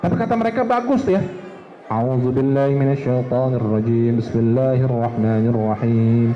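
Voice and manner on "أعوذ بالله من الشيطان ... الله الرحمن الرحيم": Quran recitation. Shown as a demonstration. Ustadz demonstrating Quran recitation in a low chant. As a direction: recite the verses in a long sung tilawah.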